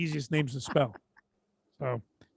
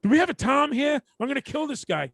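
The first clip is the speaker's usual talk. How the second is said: mock angry boss; 8 semitones higher, 3 dB louder than usual. Boss flipping out quote.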